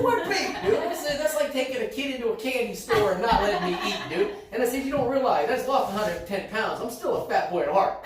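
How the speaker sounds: high, loud comic outrage; playfully worked-up complaint; mock indignant protest. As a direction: protest loudly, half laughing. Acted protest at being baited.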